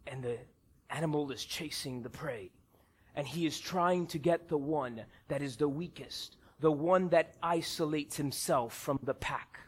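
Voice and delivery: very low, hushed documentary narrator; low, quiet, measured wildlife narration; deep narrator voice. Retold or imitated. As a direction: narrate a nature film in a deep, soft voice. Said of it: "in his very nice, deep voice".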